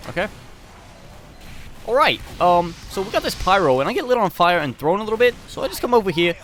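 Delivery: high, loud, brash character voice; Scout. Acted. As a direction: yell it all in a high-pitched game-character voice. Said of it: "Hey, Scout, let's finish off the episode".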